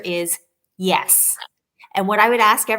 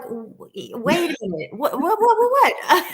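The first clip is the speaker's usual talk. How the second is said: stammering, then climbing much higher and louder with a laugh; startled disbelief; 8 semitones higher, 4 dB louder than usual. Retold or imitated. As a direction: caught completely off guard in the car, voice shooting up. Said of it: "I was like".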